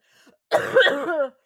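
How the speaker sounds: loud hacking cough burst; chesty cough. Real, not acted. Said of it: "sorry, coughing fit".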